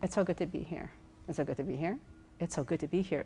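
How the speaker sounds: quiet, low "It's so good to be here"; soft tone demo. Shown as a demonstration. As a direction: say it small and softly to show weak tone. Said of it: "The other thirty percent is tone".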